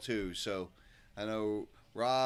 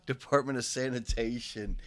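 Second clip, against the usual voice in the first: put-on New York accent; 2 semitones higher, 5 dB louder than usual. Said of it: "any more New York stuff".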